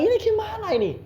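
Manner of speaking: high-pitched mock complaint; incredulous protest. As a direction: imitate exasperated friends, voice shooting up. Friends protest his suggestion to sleep. (protes heran bernada tinggi)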